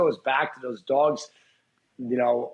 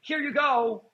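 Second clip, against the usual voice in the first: mock offering a treat; 8 semitones higher, slower than usual. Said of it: "I wasn't like".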